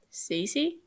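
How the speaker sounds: soft, rising question; quiet curious. A narrator's reading. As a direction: ask gently, curious. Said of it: "quiet but clearly curious".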